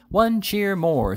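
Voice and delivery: high, slow, stretched cry to the crowd; scream. A narrator's reading. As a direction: drag out a rousing yell to get them cheering. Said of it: "screamed the little Fugleman".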